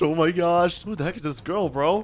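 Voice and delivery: ordinary talk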